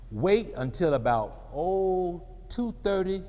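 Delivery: ordinary talk, nothing marked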